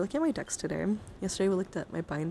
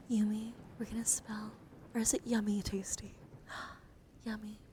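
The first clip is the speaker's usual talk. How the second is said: ASMR name spelling; 7 dB quieter than usual. Acted. Streamer spelling a new follower's name, hushed ASMR voice. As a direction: spell it softly with long pauses.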